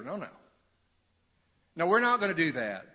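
ordinary talk, nothing marked

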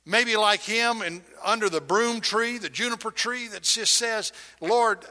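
preacher pleading with the congregation; impassioned preaching. Acted. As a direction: press each question home.

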